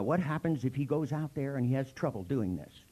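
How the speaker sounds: usual speaking voice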